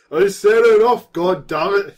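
high, loud, slow angry yell; furious shout. Acted. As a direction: bellow it at the students. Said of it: "shouted the professor".

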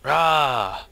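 loud, high "rah" of exertion; effort yell. Acted. Character heaving someone up, loud effort yell. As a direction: yell with one big heave.

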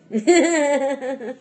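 a burst of real laughter; loud real laugh. Real, not acted. Laughs after her annoyed rant.

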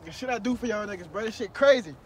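normal talking voice

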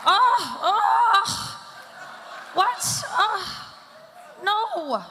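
high, loud, laughing squeals of oh; Jennifer Aniston impression. Stand-up showing off her Jennifer Aniston impression, loud high squealing laughs. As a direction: shriek with delight like Jennifer Aniston. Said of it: "I can do a Jennifer Aniston impression".

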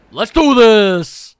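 loud, drawn-out battle cry; rallying cry. Acted. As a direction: throw it out loud and long. Character hyped before a big meal.

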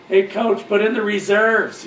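loud, high call across the court; retold teasing yell. Players teasing the rival coach from the court, yelling over. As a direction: holler it over to needle the coach. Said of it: "We'd yell over".

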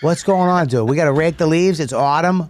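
hammed-up Boston accent. Boston local fussing over autumn leaves to a pal, mock Boston accent. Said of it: "in a Boston accent".

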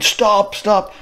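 high-pitched retold call to halt; mimicked chasing lads. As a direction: imitate pursuers calling out, pitched well up. Retold lads calling while chasing.

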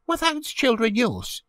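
put-on very high child's question; wondering child, pitched high; curious boy. A narrator's reading. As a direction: ask it in a small boy's high voice. Boy suddenly asks the lady.

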